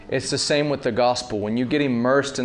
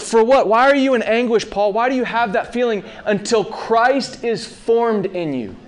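impassioned preaching; 8 semitones higher, 3 dB louder than usual. Real, not acted. Preacher presses the question at full force.

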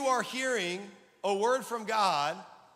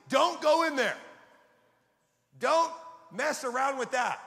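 urgent, raised-voice warning; shouting a command as the Holy Spirit; imitated shout; 4 semitones higher, 6 dB louder than usual. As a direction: call out a loud, higher-pitched warning. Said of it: "Holy Spirit shouted into me".

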